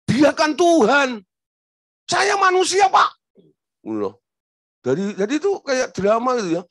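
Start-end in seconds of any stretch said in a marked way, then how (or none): 0.1-3.1 high, loud retort, "Tuhan" falling hard; loud protest. Retold or imitated. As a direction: shout it back as a challenge. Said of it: "suaranya lantang". (suara lantang dan tinggi, menantang)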